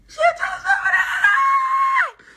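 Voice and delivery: high squealing laugh through the words; laughing mock yell. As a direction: yell it while cracking up. Mocking reply, laughing.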